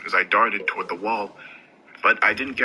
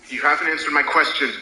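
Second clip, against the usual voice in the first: higher, firmer demand; rising anger; 6 semitones higher, faster than usual. A narrator's reading. Narrator confronts Gideon, growing angry. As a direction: press him, fear turning to rage. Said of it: "my fear began to turn into rage".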